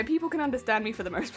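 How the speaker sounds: normal talking voice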